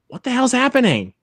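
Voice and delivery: high-pitched mock confusion; bewildered reaction. Viewer recalling chuckles turning to confusion, bewildered. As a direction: jump up high in acted disbelief. Retold confusion while watching.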